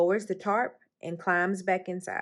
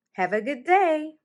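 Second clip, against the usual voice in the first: higher, louder call across the room; 8 semitones higher, 7 dB louder than usual. A narrator's reading. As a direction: call out a cheery goodbye. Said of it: "Mommy calls".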